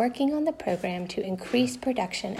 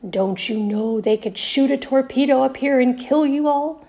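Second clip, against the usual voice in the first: louder, higher, rough officer's bark; stern growl; 5 semitones higher, 6 dB louder than usual. A narrator's reading. Army officer warns the boys off the bridge, growling. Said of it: "He growled".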